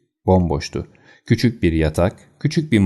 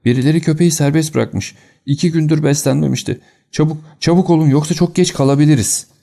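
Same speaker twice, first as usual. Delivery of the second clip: put-on much higher, louder, frantic old man; panicked cry; 8 semitones higher, 3 dB louder than usual. A narrator's reading. Old man warns them the dog is loose, panicked higher cry. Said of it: "diye bağırdı".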